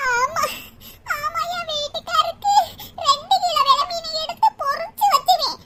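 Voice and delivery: crying while recalling a husband's favourite dish; tearful. Acted. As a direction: lament it through tears. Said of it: "அத பாத்துக்கிட்டே அத்தை அழுகுறாங்க".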